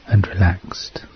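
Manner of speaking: soft breathy whisper. Acted. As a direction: whisper it, drifting off. Whispered repeat of the line.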